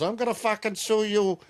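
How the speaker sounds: high mock-angry mother; angry mother quote. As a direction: squeal it like an outraged mom. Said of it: "And the mother goes".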